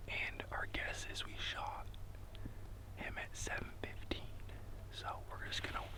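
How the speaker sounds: hushed hunting whisper. Real, not acted. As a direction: whisper so the elk won't hear. Hunter whispering in the field.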